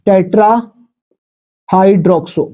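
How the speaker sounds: tetrahydroxo drawn out very slowly for students to write; slow dictation. Shown as a demonstration. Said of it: "ये हो गया tetrahydroxo". (tetrahydroxo को बहुत धीरे खींचकर लिखवाना)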